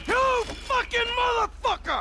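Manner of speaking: high, raging scream of insult; betrayed husband screaming at the coach; furious shout. Acted. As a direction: explode at him. Rage at wife's lover.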